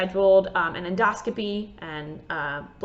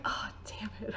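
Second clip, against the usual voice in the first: hushed whisper; whispered curse; 15 dB quieter than usual. Retold or imitated. At her desk, cursing at the forbidden snacks, whispered. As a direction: mutter it under your breath. Finding Wheat Thins on desk.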